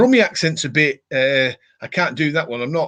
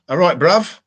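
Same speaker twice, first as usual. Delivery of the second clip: higher, louder Cockney greeting, badly faked; louder mock Cockney; 5 semitones higher, 5 dB louder than usual. Shown as a demonstration. Trying a Cockney accent for viewers, louder and higher. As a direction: belt out a cheeky Cockney hello. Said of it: "I can't do it".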